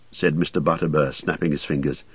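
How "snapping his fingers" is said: usual speaking voice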